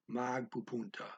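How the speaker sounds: ordinary talk, nothing marked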